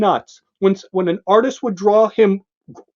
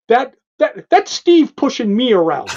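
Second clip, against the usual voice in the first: lightly voiced petulant scientist, raised high; mock whine; 4 semitones higher than usual. A hint of Langowski whining about being pushed around. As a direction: whine it like a spoiled genius.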